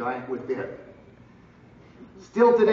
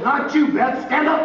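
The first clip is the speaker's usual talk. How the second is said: loud, high call to his partner, half laughing; laughing yell; 10 semitones higher, 8 dB louder than usual. Retold or imitated. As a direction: shout at her to get up, amused. Partner lies down by mistake.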